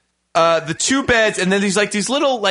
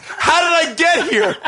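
confused sleepwalker; 8 semitones higher than usual. Retold or imitated. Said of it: "confused sleepwalker's question".

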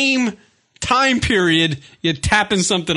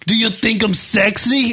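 hammed-up Russian accent; a stripper flirting, in a mock Russian accent; 4 semitones higher than usual. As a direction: purr it in a bad Russian accent. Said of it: "what a Russian sounds like".

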